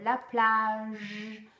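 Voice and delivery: stretched "plage"; stress final j. Shown as a demonstration. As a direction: lean on the last "j" sound of "plage". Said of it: "vous insistez sur le son à la fin du mot".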